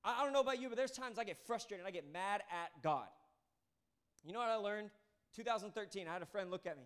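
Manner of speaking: ordinary talk, nothing marked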